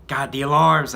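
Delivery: loud, high alarmed shout; yelling. Acted. Hero hearing the alarms, loud high yell. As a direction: cry out at the alarms. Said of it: "I yell".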